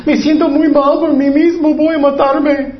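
high-pitched, hammed-up despair; mock self-pity. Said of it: "Él solamente sentía mal".

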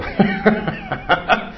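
a short airy laugh at the mock insult; amused exhale between the quotes; breathy laugh. Real, not acted. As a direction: laugh under your breath.

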